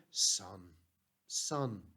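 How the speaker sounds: low, softened, fatherly call; quiet, low-pitched affection; tender address. Acted. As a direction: speak gently, with a pause between words. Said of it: "Jesus didn't treat the guy as an enemy".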